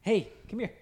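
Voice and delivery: high call to the dog. Real, not acted. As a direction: coax the dog over with a high hey. Calling the dog over.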